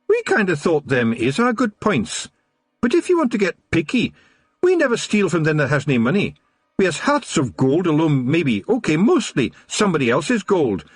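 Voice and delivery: high, teasing Scots brogue; amused Scottish pixie. A narrator's reading. Rob Anybody teasing Wee Mad Arthur, high amused Scots. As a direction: rib him like a cheeky big brother. Rob lists good points.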